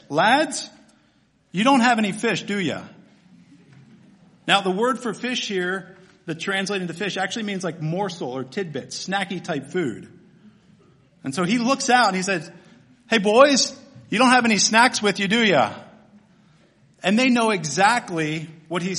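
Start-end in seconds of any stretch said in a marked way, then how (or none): 0.1-2.8 raised, teasing call, dropping on "do ya"; condescending. Acted. Stranger on the shore to empty-handed fishermen, condescending. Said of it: "little condescending". 13.1-15.7 loud, higher, quick hail across the water; condescending. Acted. Jesus hollers from shore to the disciples about snacks, condescending. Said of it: "little condescending".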